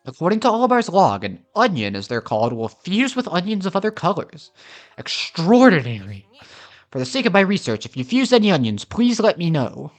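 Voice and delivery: keen, lively scientist character voice; animated, pitch swooping on key phrases; eager researcher. Acted. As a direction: play an enthusiastic researcher making an appeal.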